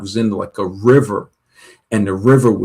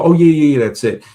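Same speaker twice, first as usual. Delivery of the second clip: louder, higher start, then dropping back; mock eager agreement; 5 semitones higher, 4 dB louder, faster than usual. Fake psychic agreeing to anything you say, mock eager. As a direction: imitate a too-quick yes.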